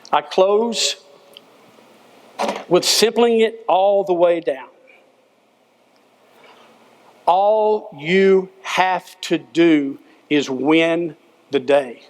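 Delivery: slow it right down to the key line; drawn-out closing line; slow emphatic close. Real, not acted. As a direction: land the final lesson slowly.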